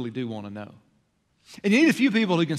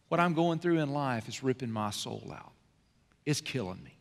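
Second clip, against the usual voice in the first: much softer and lower, heavy; quiet confession of pain; 4 semitones lower, 7 dB quieter than usual. Retold or imitated. Hurting person telling loved ones their pain, quietly. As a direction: confess it almost under the breath.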